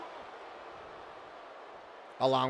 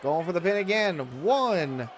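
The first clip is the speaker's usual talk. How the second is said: wrestling commentator; 4 semitones higher, slower than usual. Acted. Live wrestling game play-by-play.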